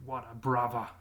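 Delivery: a touch of Jason Statham's Cockney, dropped t in "Water"; lightly imitating Cockney English, "Water" said "wawa"; Cockney impression. Vlogger quoting Jason Statham in mild Cockney. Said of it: "like Jason Statham used to say".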